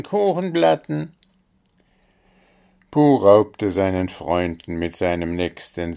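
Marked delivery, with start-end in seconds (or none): 0.0-1.1 high chant, a bit louder; chorus calling again. A narrator's reading. The animal chorus repeating its call to the gnome, chanting. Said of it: "der bunte Chor hinter ihm rief".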